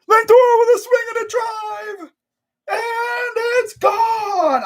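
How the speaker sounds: very high, drawn-out home run call; hyped. Acted. Fan recreating the Lindor grand slam call, hyped. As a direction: stretch the last word in a hyped scream. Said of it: "gets hyped for that".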